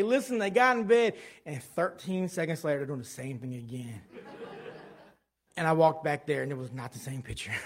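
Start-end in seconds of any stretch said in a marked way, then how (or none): none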